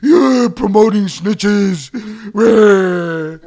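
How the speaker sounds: loud, slow, high crybaby voice with a wah; mocking whiny critic. Streamer mocking chat critics as crybabies, loud whiny whimper. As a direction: ham up a whining complainer. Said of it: "they'll be like".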